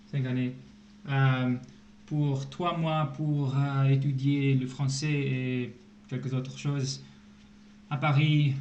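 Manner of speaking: carefully string the French words together; speaking French. Shown as a demonstration. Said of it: "tell us something in French".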